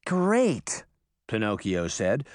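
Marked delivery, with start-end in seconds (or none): 0.0-0.8 high, drawn sarcastic reply. A narrator's reading. Reluctant boy answering Daphne's plan, thick sarcasm. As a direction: lay the false enthusiasm on thick. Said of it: "through a thick layer of sarcasm".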